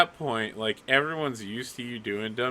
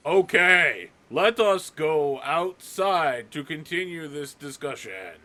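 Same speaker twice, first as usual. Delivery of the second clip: loud, stagey announcement, slowing down; 3 semitones higher, 3 dB louder, slower than usual. Acted. Buckley covers secret telepathy out loud, very loud. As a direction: announce it pointedly for everyone to hear. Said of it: "So very loudly, Buckley goes".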